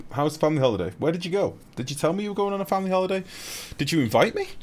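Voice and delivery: high, loud pretend hurt questions; mock-hurt streamer grilling a viewer about a holiday; mock offended. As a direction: act wounded that you weren't invited.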